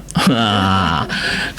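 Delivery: a short, low real laugh; amused low chuckle. Real, not acted. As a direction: chuckle at yourself. Laughing at his own sock hiding.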